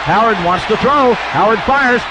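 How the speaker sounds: ordinary talk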